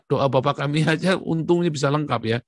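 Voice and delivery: ordinary talk, nothing marked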